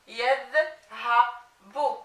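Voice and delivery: yadhabu broken into slow, separate syllables; very slow, segmented "yadhabu" for a learner. Shown as a demonstration. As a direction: sound out "yadhabu" with gaps between the parts. Teacher assembles word from spelled syllables.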